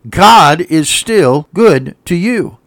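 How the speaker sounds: each word slow and deliberate; slow emphasis. Real, not acted. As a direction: space out "God is still good to you". Repeats the line for emphasis.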